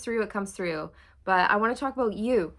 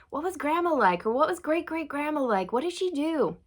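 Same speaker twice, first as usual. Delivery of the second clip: lightly acted, higher-pitched curious questions; eager questioner; 7 semitones higher than usual. Asking mom or aunties about grandma, mock curious. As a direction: ask relatives eagerly, pitched up.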